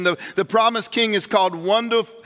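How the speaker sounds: ordinary delivery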